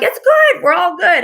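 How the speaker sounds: high, chirpy, falsely cheerful; a high, bright "all good" in mock denial; breezy mock reassurance. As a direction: brush it off brightly, pretending all is fine. Mock self, pretending all is fine.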